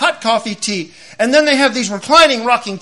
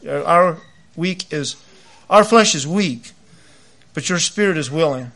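prayer; 3 semitones lower than usual. Acted. Closing prayer.

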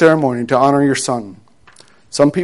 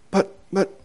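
stammering father; 5 semitones higher, 9 dB quieter than usual. Acted. Father stunned by the news.

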